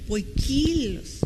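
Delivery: soft, slow "Poikilos" with a long KI; stress on the I. Shown as a demonstration. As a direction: lean on the i of "Poikilos", poi-KIII-los. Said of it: "lleva acento en la I". ("Poikilos" suave y lento, alargando la i acentuada)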